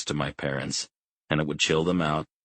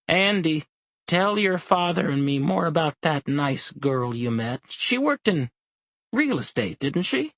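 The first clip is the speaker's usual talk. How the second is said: put-on much higher, sweet old woman's voice; sweet old mother; 12 semitones higher than usual. A narrator's reading. Elderly mother to her son, asking sweetly about a girl. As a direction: ask it brightly like a doting mother.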